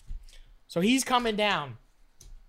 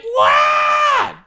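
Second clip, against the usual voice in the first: much higher, much louder shocked shout; huge, high retold yell of shock; 10 dB louder than usual. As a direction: yell it in total disbelief. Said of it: "And I'm like".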